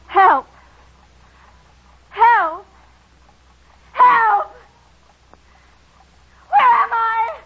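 desperate rising screams for help; cry for help. Acted. Trapped woman calling for help.

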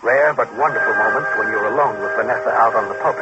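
tense second-person storyteller; story narration. A narrator's reading.

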